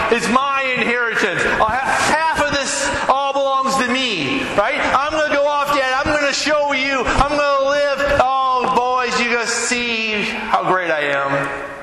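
high, cocky voice of a son grabbing his inheritance; brash young son. Acted. Son boasting to his father about leaving, high and cocky. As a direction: swagger it like a spoiled kid leaving home.

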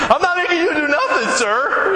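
high-pitched, comically meek placating; hammed-up deference to a scary big man; mock timid. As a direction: back down nervously, played for laughs. Placating a huge intimidating man.

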